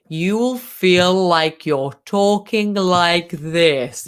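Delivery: very slow, stretched-out words; exaggerated slow pace. Shown as a demonstration. Coach mocking how slowing down feels, exaggeratedly slow. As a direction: drag every word out to half speed. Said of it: "By slowing down the pace".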